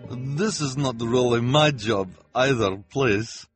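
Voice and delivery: high, loud anxious pleading; nervous protest. Acted. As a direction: protest as a nervous fortune teller. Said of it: "protested, looking at the children nervously".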